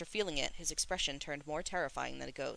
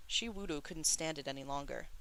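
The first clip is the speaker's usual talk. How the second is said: usual speaking voice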